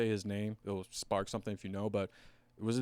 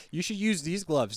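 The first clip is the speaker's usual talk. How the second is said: mock urging; 7 semitones higher, 6 dB louder than usual. Repeats acted glove-swap pitch.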